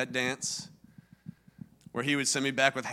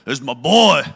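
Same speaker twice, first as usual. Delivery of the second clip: much louder, lower booming boast; dad showing off his son, booming; 3 semitones lower, 13 dB louder than usual. Retold or imitated. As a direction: boom it like he owns the room. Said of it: "Sounded like this".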